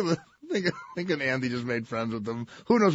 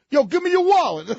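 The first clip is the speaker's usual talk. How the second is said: high, loud Brooklyn mugger; Brooklyn accent; 7 dB louder than usual. Acted. Brooklyn mugger demanding a wallet, loud Brooklyn accent. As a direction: bark it like a Brooklyn street tough. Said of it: "doesn't sound like Brooklyn".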